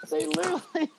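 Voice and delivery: usual speaking voice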